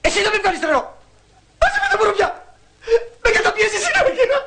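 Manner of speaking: very high, overwrought protest; hysterical protest. Acted. Cornered husband accusing his wife, hysterical. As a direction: wail it like the wronged party. Husband counter-attacks in acted quarrel.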